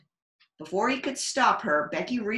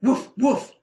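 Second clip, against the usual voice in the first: teasing bark; 5 semitones higher, 7 dB louder than usual. Acted. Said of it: "Becky said with a laugh".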